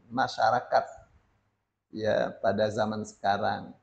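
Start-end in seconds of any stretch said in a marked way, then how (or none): none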